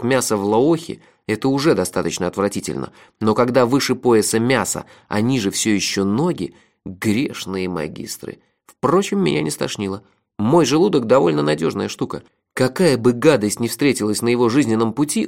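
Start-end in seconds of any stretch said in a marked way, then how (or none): none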